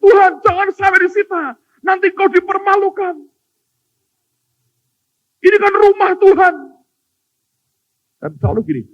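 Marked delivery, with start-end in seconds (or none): 0.0-6.5 high, loud pleading prayer; desperate prayer. Retold or imitated. Cornered believer begging God, high loud plea. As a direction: beg God up high, all in. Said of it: "Kalau sudah kecepit".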